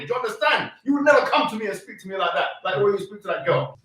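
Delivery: normal talking voice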